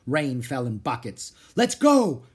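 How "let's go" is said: high, very loud call; urgent cry. A narrator's reading. Girl to brother as storm breaks, urgent shouting. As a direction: cry out to get moving. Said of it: "cried Annie".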